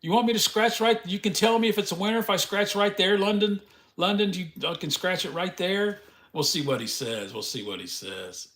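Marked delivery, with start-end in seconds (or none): 0.0-6.0 high, loud teasing mock-dumb act; string the scammer along, loud and higher. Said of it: "I'll say". 7.3-8.5 softer, lower echo to himself; quiet repeat. Real, not acted.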